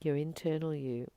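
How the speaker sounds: ordinary talk, nothing marked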